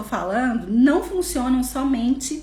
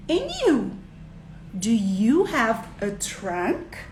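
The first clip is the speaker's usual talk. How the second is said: put-on slow child-directed question, pitched up at first; asking a child; much slower than usual. Shown as a demonstration. Asking the child about a trunk, slowly and deliberately. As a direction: ask it slowly, leaving space for an answer. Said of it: "E pergunta pra criança".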